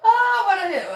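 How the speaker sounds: words breaking into a laugh. Real, not acted. Laughing with the viewers as she talks.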